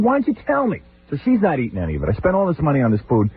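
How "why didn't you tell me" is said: high, whining complaint; to mother-in-law about dinner, high mock whine; mock exasperation. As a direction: squeak it out, fed up. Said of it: "I said".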